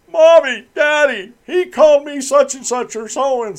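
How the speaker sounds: put-on high, loud whiny kid voice; tattling child. Host imitates a child tattling to mommy and daddy, high and whiny. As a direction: tattle like a little kid to the parents.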